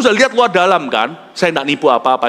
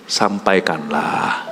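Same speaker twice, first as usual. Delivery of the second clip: "sampaikanlah" said much lower, softer and slowly; 13 semitones lower, 5 dB quieter, much slower than usual. Shown as a demonstration. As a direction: hush "sampaikanlah" down gently and slowly. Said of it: "bisik-bisik pelan-pelan aja". ("sampaikanlah" diucapkan pelan, lirih dan rendah)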